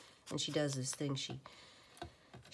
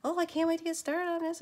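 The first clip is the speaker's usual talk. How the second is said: much higher, hammed-up eager voice; mock eager; 6 dB louder, faster than usual. Woman mocking her own morning eagerness in a high, hammed-up voice. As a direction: squeal it like an overexcited morning person. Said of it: "I come down all like".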